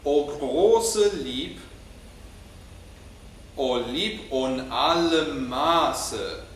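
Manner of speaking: große stressed, slow and deliberate; slow spoken German. Shown as a demonstration. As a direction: lean on große with a rising tune, very slow. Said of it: "speak the German and you repeat it after me".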